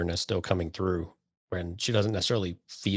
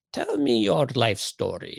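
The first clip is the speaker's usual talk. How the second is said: slow, higher, mocking therapist-like voice; mock interrogator; 6 semitones higher, slower than usual. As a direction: drawl it out like a fake interrogator. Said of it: "you gotta put the finger quotes".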